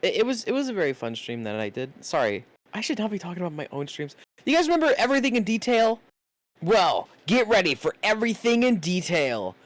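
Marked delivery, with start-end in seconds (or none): 4.4-9.6 hype announcer. Pretend. Hyped announcement of stream.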